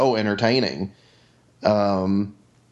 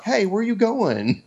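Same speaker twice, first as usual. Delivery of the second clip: high, slow, fake-innocent question; clueless innocent mean girl; 10 semitones higher, slower than usual. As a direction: play dumb, all sweet surprise. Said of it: "the other girl's like".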